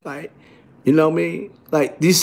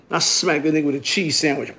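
ordinary delivery

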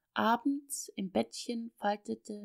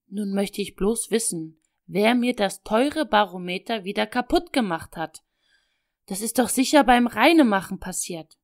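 louder, indignant father scolding the house; upset and angry; 8 dB louder than usual. Acted. Father scolding about the broken barometer, angry. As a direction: demand an answer, rising in anger. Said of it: "Sie klang aufgebracht".

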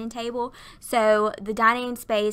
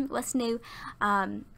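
ordinary talk, nothing marked

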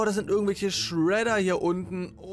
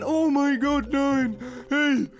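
much higher, louder panicked cries; 7 semitones higher, 3 dB louder than usual. Real, not acted. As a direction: beg in panic as it catches you. Caught by monster in game.

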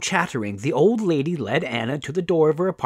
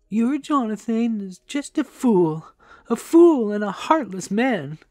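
high-pitched, aggrieved storytelling voice; indignant woman; 11 semitones higher, 4 dB louder than usual. A narrator's reading. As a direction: protest an injustice in a raised pitch. Indignant talk about the husband.

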